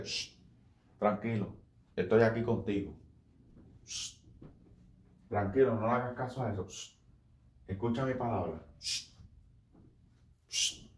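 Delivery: soft shushing, hushed and tender; soothing hush. Acted. Holy Spirit calming the troubled preacher, soft soothing hush. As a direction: hush him gently like calming a child.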